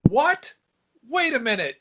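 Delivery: high startled cry; surprised. Retold or imitated. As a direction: caught off guard, stop him. Mother reacting from upstairs.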